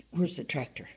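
usual speaking voice

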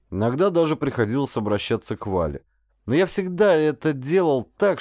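ordinary delivery, nothing marked

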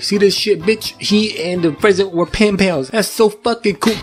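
high, put-on cartoon voice; gleeful squeaky bragging; mock character voice. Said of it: "he reads it and he's like".

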